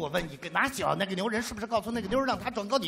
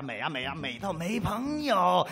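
drawn out slowly; slower than usual. Retold or imitated. Drawn-out gushing over beauty.

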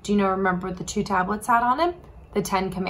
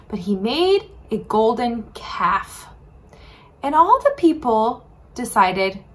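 normal talking voice

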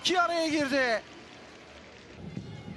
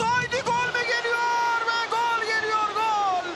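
screaming football commentator; 5 semitones higher than usual. Real, not acted. Goal call, commentator erupts.

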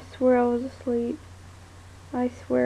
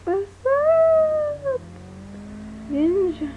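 higher, squeaky mock greeting to Ninja; 12 semitones higher, much slower than usual. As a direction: chirp a fake cheerful hello. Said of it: "and be like".

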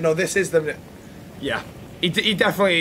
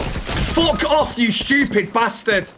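high, drawn-out angry curse; exasperated cursing; 3 semitones higher, much slower than usual. Real, not acted. Gamer cursing the enemy attacking him, exasperated. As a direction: swear slowly at the attacker.

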